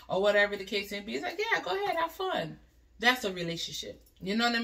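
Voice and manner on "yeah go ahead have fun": high-pitched agreeable partner voice; easygoing permission. Retold or imitated. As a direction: imitate a partner happily waving someone off. Acted partner cheerfully giving permission.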